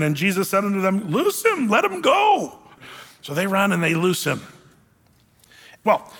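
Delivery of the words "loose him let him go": high-pitched calling out; ringing, much higher command; acted command. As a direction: call out the order, voice lifted high. Acts out Jesus's command to onlookers.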